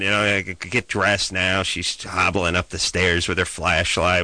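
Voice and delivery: ordinary delivery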